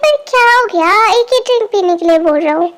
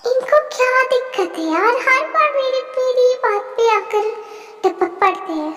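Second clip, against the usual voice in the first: higher, softer annoyed mutter; irritated aside; 4 semitones higher, 6 dB quieter than usual. Acted. Sister mutters about him butting in, a soft exasperated grumble.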